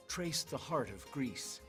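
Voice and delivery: ordinary delivery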